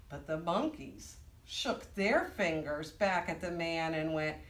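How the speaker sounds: usual speaking voice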